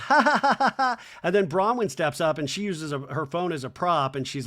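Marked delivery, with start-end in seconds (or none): 0.1-1.0 high, loud imitated giggle; mock giggle. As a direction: giggle in a put-on staccato run. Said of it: "And Lisa giggles".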